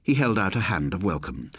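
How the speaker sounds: ordinary delivery, nothing marked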